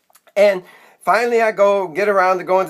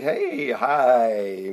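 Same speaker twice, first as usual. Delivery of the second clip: loopy, lower-pitched stretched greeting; heavily medicated; 6 semitones lower than usual. Acted. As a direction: greet strangers as if happily doped up. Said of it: "heavily medicated".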